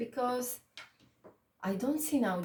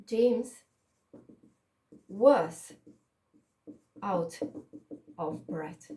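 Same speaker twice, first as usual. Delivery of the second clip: slow, separated words with long gaps; word-by-word dictation; 2 semitones lower, 4 dB louder, much slower than usual. Shown as a demonstration. Teacher writing an example for the student, dictating word by word. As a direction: dictate while writing, punching "was" loud.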